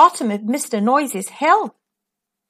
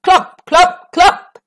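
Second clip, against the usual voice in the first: heavy footsteps; 7 semitones higher, 5 dB louder, much slower than usual. Acted.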